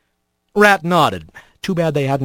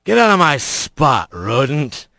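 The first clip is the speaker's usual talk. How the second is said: slurred voice; 3 semitones lower than usual. A narrator's reading. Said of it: "A slurred voice behind him".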